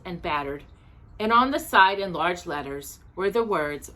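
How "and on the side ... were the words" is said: ordinary delivery, nothing marked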